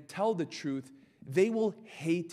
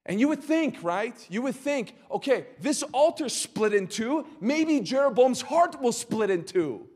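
hopeful wonder; 7 semitones higher, 5 dB louder than usual. Acted. Imagined hopeful thought, high.

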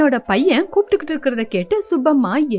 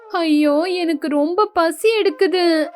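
higher, slow whimpering plea; whiny crying; 4 semitones higher, slower than usual. Acted. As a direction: whine like a hungry child near tears. Lazy bride whines for food.

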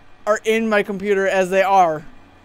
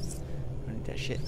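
breathy whispered refusal, soft; 9 semitones lower, 13 dB quieter than usual. Acted. Refusing the offered gun in a whisper. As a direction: whisper it, almost to yourself.

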